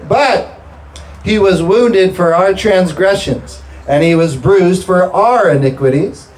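high, slow scripture proclamation; emphatic preaching. Real, not acted. Preacher proclaiming Christ's wounds to the congregation, emphatic preaching. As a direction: proclaim each phrase with drawn-out weight.

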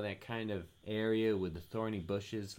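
ordinary delivery

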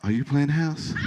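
soft, probing "Are you playing house?"; concerned question. Shown as a demonstration. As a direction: ask it gently but pointedly. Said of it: "look at him and say this".

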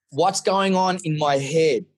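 normal talking voice

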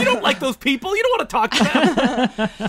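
laughing protest. Real, not acted. Objecting to office socializing, laughing protest. Mock-appalled at socializing with coworkers.

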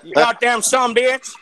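hammed-up country American cussing; bad American accent. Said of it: "That is the worst American accent ever".

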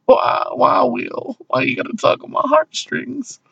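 high, cracking mock wail, hammed-up; hammed-up howl at the screen. Tearing up at the trailer, he wails in mock despair. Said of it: "every time I'm just like".

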